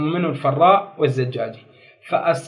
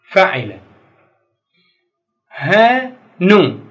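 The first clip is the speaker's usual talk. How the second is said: usual speaking voice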